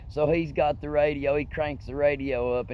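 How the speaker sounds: ordinary delivery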